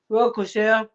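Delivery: ordinary delivery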